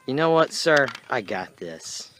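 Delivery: bold declaration. Acted. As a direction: announce it boldly. Heroic volunteering.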